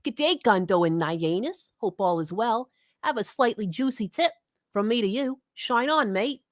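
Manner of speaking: a clumsy Aussie donation read; bad Australian accent. Reading an Aussie viewer's donation in a bad Australian accent. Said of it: "That sounds like a bad New York accent".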